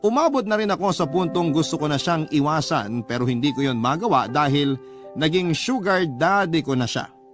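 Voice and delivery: ordinary talk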